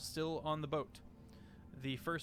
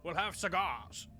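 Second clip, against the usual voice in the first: lively, pleased command, a bit higher; commanding officer; 5 semitones higher, 3 dB louder than usual. Acted. Commander ordering the crew, pleased and commanding. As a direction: play the eager commander.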